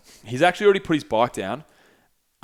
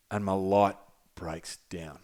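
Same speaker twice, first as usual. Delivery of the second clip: low, slow, hushed retelling; deadpan grim reveal; 5 semitones lower, 8 dB quieter, slower than usual. Retold or imitated. Friend confessing his bike light failed, low and grave. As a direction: drop it quietly and let each word hang.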